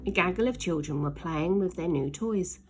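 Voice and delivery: ordinary delivery, nothing marked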